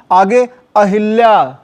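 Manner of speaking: ordinary talk, nothing marked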